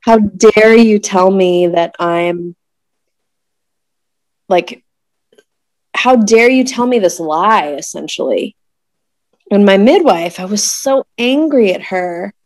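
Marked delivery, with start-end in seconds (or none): 0.0-8.6 mock-furious, spelling out each word; retold rage. Imitating her silent fury at the midwife, slow and indignant. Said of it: "just filled with rage".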